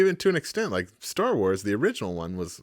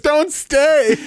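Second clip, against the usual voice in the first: mock wail; 14 semitones higher, 5 dB louder than usual. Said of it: "You were like".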